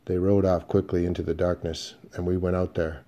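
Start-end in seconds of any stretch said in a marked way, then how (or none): none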